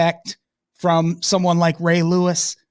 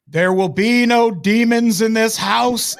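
booming, higher religious pronouncement; stern preacher; 6 semitones higher than usual. Retold or imitated. Mocking Antoine scolding Michael for cussing, booming preacher voice. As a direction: lay down the law like a pastor. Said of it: "Antoine is like".

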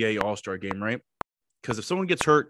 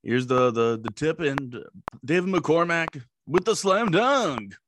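booming announcer; 3 semitones higher, slower than usual. Retold or imitated. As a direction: call the dunk like a radio play-by-play man. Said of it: "I can't even do a Brian Haney".

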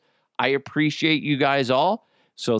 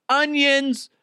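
triumphant high reveal; 13 semitones higher than usual. Acted.

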